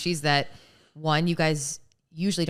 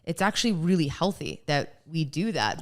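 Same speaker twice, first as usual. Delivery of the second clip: ordinary talk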